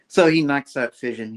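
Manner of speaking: ordinary delivery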